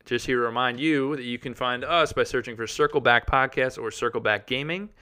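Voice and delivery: loud, bright podcast promo read; upbeat announcer. Acted. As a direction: sell it like an ad. Recorded outro announcement.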